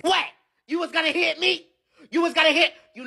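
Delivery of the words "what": ordinary talk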